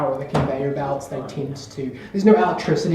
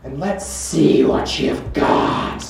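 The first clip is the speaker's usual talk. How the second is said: gloating Countess; 3 semitones higher than usual. Acted. The Countess reveals herself as the Assassin, grandly menacing. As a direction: savor the reveal, slowing into the challenge. Game master plays villain's reveal.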